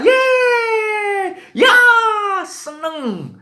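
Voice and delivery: high, loud, stretched-out cheer; imitated joyful shrieking. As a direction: squeal like thrilled little children. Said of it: "mereka berteriak sukacita".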